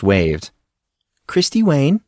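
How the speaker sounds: plain reading voice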